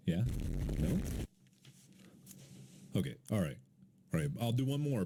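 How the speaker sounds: normal talking voice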